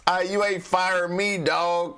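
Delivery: swaggering mock Powell; street-tough Powell. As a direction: talk back like a tough guy. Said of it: "Jay Powell said".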